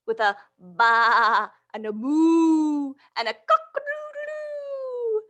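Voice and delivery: playful barnyard refrain; animal noises. Acted. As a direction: ham up the farm animal sounds for the kids.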